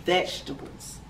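ordinary delivery

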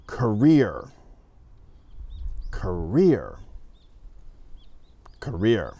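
career said the native English way; English word dictation. Shown as a demonstration. Teacher reads career for students to write. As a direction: read career clearly for dictation. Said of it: "say a word with my accent".

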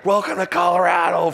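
high, loud mocking chant; jeering crowd. Crowd heckling the new pitcher, high mocking call. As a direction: taunt him like a heckler. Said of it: "from the crowd".

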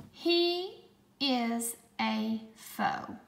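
slow, word-by-word dictation; slow, clear dictation voice; dictation pace. Shown as a demonstration. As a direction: read it out slowly for spelling. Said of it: "four words".

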